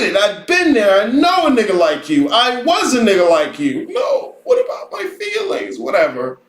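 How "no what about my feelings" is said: whiny mock voice. Mocking whiny student voice.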